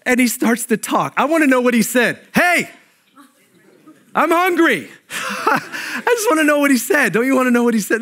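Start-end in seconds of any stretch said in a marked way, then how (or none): 2.3-4.9 risen dead man. Retold or imitated. 5.1-8.0 laughing through the words; cracking up at his own joke; giggling, real amusement. Real, not acted.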